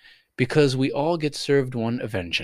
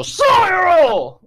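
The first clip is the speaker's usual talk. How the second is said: high, drawn-out, very loud holler; sudden scream; 10 dB louder than usual. Real, not acted. Host startling the cat at random, sudden loud scream. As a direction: burst out screaming mid-sentence. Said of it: "I just screamed".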